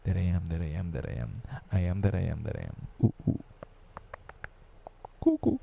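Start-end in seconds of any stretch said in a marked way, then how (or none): 5.2-5.6 high bird cuckoo call, much higher. Pretend. Calling himself cuckoo, high bird cuckoo call. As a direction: sing out a cuckoo like a clock bird.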